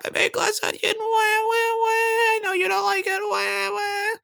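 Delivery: squeaky high fake sobbing wah wah; mechanical crying doll. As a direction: bawl like a wind-up crying doll. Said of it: "That's it".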